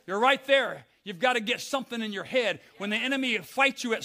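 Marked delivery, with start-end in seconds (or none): none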